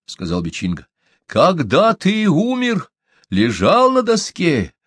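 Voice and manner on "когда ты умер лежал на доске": high, loud, slow shaman voice; shaman's question. A narrator's reading. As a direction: call out slowly to a dead man's soul. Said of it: "обратился шаман к подушке".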